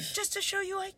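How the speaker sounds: mock soft reply. Ex's reply, quoted.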